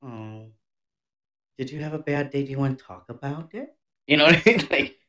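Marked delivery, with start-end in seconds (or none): 0.0-3.7 hushed, sugary fake concern; soft mock sympathy. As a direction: coo it like you care, quietly. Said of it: "but you're like".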